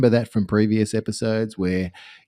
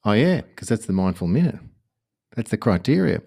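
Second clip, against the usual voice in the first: ordinary delivery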